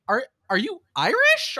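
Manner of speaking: high, stammered disbelief; puzzled and pitched right up; incredulous question. Retold or imitated. Viewer questioning the actor's suspect accent, incredulous.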